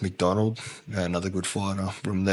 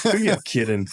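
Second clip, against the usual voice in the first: a higher, louder scoff; 6 semitones higher, 4 dB louder than usual. Retold or imitated. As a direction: call out his fib, half-laughing. Scoffing at the claim.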